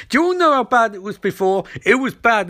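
high-pitched acted exasperation; indignant mock rant. [name] berates the team, acted quarrel.

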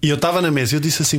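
ordinary talk

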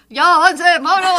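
retold shout. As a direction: call out impatiently to the crowd ahead. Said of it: "todavía gritando".

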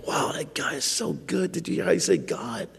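breathless whispered admiration; awed whisper. Retold or imitated. Preacher voicing a dazzled congregant, awed whisper. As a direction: whisper it like a starstruck churchgoer. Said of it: "You say".

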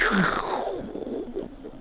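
long voiceless whoosh; drawn-out mouth-made roar of air; imitated rushing air. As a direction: make a harsh rush of wind with the mouth. Imitated noise of the jump.

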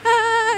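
high yell as she slashes; battle cry. Acted. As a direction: let out a sudden high war cry. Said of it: "Marge is just like".

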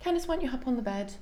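high, matter-of-fact routine check-up tone; like an appointment. Retold or imitated. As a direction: treat it as a routine visit. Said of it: "literally like an appointment".

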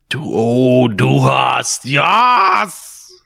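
hammed-up German rock shout, rising to a high yell; mock German. Host sings Rammstein to friends, mock German shout. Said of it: "Sorry, that's my German".

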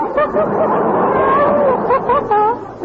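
a bright, high-pitched giggle. Real, not acted. [name] laughs at her joke.

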